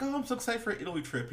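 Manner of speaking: high, rushed, quoted excitement; mock excitement. Wife tells husband about Italy trip, mock excited.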